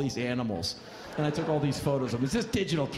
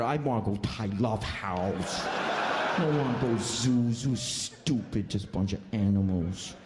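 slow, whining mock of the driver; mocking whine; 5 semitones lower, slower than usual. Comic mocks the driver's sulk, slow mock whine. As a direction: whine like a spoiled kid.

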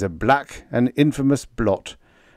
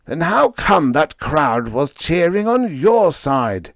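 higher, louder, slow accusing howl; 7 semitones higher, 5 dB louder, slower than usual. A narrator's reading. Leo howling at Freddie about the cheering crowd. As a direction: howl the question, outraged. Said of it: "Leo howled".

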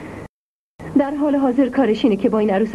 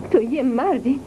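usual speaking voice

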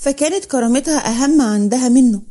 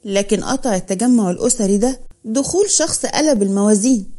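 plain reading voice

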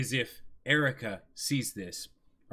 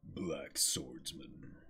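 low, soft and very slow; deep slow Griffith; 9 semitones lower, 11 dB quieter, much slower than usual. Acted. Cohost voicing Griffith's greeting, low and drawn out. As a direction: draw each word out low and ominous.

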